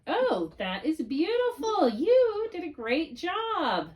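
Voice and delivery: teacher to student: praise the paper, delighted and high; enthusiastic praise. Real, not acted.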